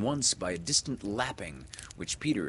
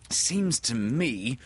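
put-on slow, louder, higher boy's grumble; mildly cross boy, voice raised; growled grumble; 5 semitones higher, 5 dB louder, slower than usual. A narrator's reading. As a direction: grumble it slowly and emphatically. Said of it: "growled John".

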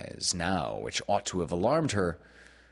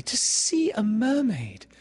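slow, high, louder rapture; longing cry; 5 dB louder than usual. A narrator's reading. Wendy longing for mermaids, high rapturous cry. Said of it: "cried Wendy".

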